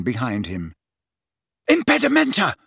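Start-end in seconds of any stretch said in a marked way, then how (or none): 1.7-2.6 high-pitched yell of a curse; yelled spell. A narrator's reading. Harry jinxing his pursuers as he rolls over, yelled. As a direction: yell it out as an urgent incantation. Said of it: "he yelled as he rolled over again".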